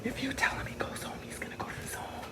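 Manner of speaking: breathy imitation of a whisper in the ear; imitated whisper. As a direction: whisper it like a secret in the judge's ear. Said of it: "whispering in his ear".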